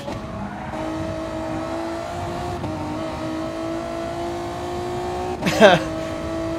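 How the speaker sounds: long wheezing laugh, helplessly tickled. Real, not acted. Streamer tickled by a joke, long helpless laughter. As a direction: lose it for a long stretch. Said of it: "that tickled me way too much".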